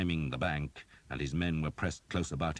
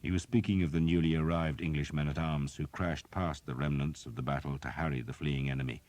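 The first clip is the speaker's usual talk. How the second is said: ordinary delivery, nothing marked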